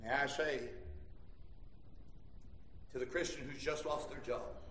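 low, soft, confiding pulpit address; hushed preaching. Real, not acted. Preacher to Christians who just lost their job, hushed preaching. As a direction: bring it right down and speak gently to them.

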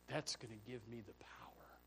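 hushed whisper of secret strength. Retold or imitated. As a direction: breathe it like a secret. Voiced secret of power.

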